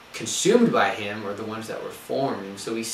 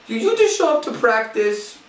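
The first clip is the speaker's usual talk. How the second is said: much higher, louder Jamaican accent, hammed up; 12 semitones higher, 8 dB louder than usual. Coach answering the frustrated runner, in a hammed-up Jamaican accent. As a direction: play the new coach in a broad Jamaican accent. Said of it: "my best take out of Jamaican accent".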